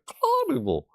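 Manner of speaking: high cawing start to "carnival"; crow caw. Acted. Streamer showing the crow pun, cawing. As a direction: squawk the first syllable like a crow. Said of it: "Crows, like".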